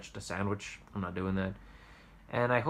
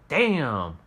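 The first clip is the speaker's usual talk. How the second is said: disappointed customer; 5 semitones higher, 7 dB louder, slower than usual. Retold or imitated. Customer replies, voiced in story.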